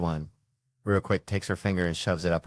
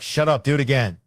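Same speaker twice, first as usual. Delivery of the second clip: impatient retold command; 5 semitones higher, 6 dB louder than usual.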